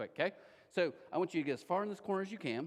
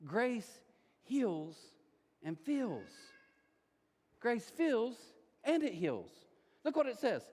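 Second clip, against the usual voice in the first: normal talking voice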